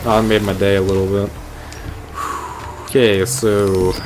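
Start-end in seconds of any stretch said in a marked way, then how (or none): 1.4-2.8 quiet breathy exhale; soft sigh of relief. Real, not acted. Gamer relieved after nearly drowning, soft sigh. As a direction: let the tension out softly.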